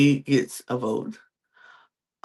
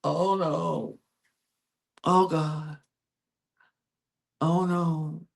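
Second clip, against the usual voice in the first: higher, soft mock gasps of "oh no"; fake shock; 4 semitones higher, 4 dB quieter, slower than usual. [name] and [name] faking surprise at the reveal, mock dismay. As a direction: ham up pretend shock. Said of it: "acting like they're shocked".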